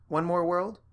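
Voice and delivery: tired rising question; weary question. Acted. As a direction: ask it with a shrug. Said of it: "she asked".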